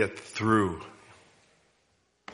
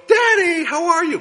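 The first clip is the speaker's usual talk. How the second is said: put-on much higher, louder, chirpy little kid calling daddy; kids' voice; 7 dB louder than usual. Preacher imitating his kids greeting tired dad, high child voice. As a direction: squeak it out like an eager child. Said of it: "and your kids go".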